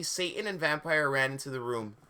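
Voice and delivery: ordinary delivery, nothing marked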